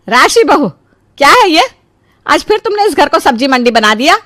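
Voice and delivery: high, loud indignant outburst; scolding mother-in-law. Acted. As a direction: call out sharply and demand an explanation. Mother-in-law scolds over messy house.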